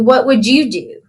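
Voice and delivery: ordinary delivery, nothing marked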